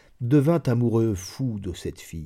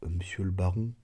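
soft hesitant murmur; old servant murmuring to the baron at dinner; 3 semitones lower, 7 dB quieter than usual. A narrator's reading. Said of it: "l'homme murmura".

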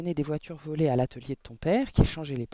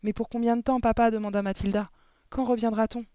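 high, raised, pleading question; anxious questioning; 7 semitones higher, 6 dB louder than usual. A narrator's reading. Girl asking how long they will be gone, anxious. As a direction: press Papa for an answer, worried.

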